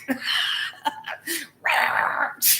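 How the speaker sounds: high cackle breaking into duck sputter; laugh, then splutter like a cartoon duck; laugh into squawk. Real, not acted. Tickled by her twin as Donald Duck, laughing squawk.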